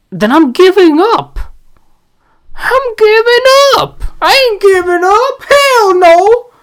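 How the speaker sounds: high-pitched defiant shouting; defiant high yell. Real, not acted. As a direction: yell it high, refusing to quit.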